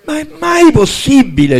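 high-pitched outburst of disbelief; indignant protest. Acted. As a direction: protest like an exasperated prince. Prince protests at proposed bride. (indignato, incredulo)

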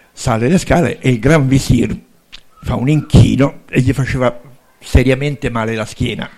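plain reading voice

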